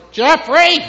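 loud, high mother's call; imitated mother shouting her child's name; angry mother. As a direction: yell the name like a cross mom. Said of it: "that would be my mother".

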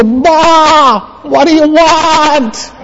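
high, drawn-out bleating sheep; slow, high bleat, questioning like a sheep; talking sheep. Acted. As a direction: answer the shepherd as a cartoon sheep. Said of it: "And the sheep will go".

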